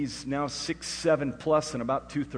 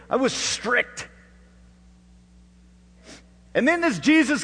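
loud, high, punchy pulpit delivery; full-force preaching; 9 semitones higher, 11 dB louder than usual. Real, not acted. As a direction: preach it out with hammered emphasis.

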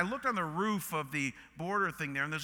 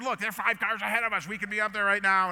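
mildly nagging wife, fast and loud; quick, raised-pitch mock complaint; exasperated nagging; 4 semitones higher, 4 dB louder, faster than usual. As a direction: retell a pointed I-told-you-so, higher and louder. Wife's nagging retold in border line.

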